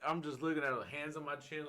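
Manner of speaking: ordinary talk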